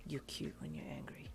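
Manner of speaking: teasing whisper in the ear. A narrator's reading. Darby teasing close in your ear, whispering. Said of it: "he whispered in your ear".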